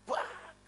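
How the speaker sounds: high sudden imitated bang; tiruan bunyi pukulan. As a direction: mimic a sudden thump on the car. Imitates thump on car window. (tiruan bunyi gebrakan bernada tinggi)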